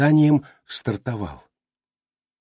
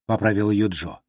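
usual speaking voice

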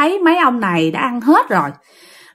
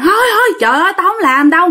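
flustered refusal; 7 semitones higher, faster than usual. Retold or imitated. Dad refusing again, flustered.

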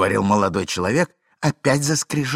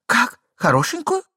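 high, sharp questioning wife; high-pitched put-on woman's voice; interrupting wife; 14 semitones higher, 3 dB louder than usual. A narrator's reading. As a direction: cut in, high and astonished. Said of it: "перебила жена".

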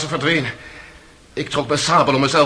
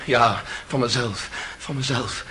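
soft and bitter, slow; bitter murmur; 4 semitones lower, 8 dB quieter than usual. Acted. Accused echoing her words, bitter and soft. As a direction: mutter it to yourself, wounded. Bitter quiet self-reproach.

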